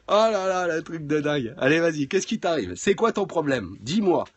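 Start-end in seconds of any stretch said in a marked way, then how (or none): none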